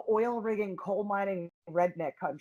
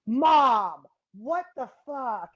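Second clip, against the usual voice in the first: shocked outburst; 3 semitones higher, 6 dB louder, much slower than usual. Retold or imitated. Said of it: "I'm like".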